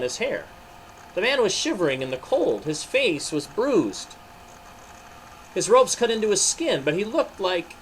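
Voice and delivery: animated storybook reading with voiced figures; story narration. A narrator's reading. Narrator telling children the trial of Jesus, animated. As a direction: read it like a children's Bible story.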